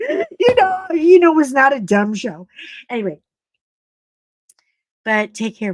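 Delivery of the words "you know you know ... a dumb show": high squeaky laughing cry; laughing protest. Real, not acted. Correcting her joke to the host, laughing high. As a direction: laugh while taking it back.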